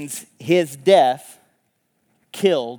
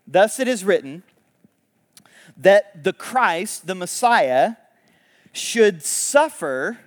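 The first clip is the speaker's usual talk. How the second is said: slow, weighty reading; solemn scripture reading; 3 semitones higher, 4 dB louder, slower than usual. Acted. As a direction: read it like a proclamation. Reads scripture summary aloud.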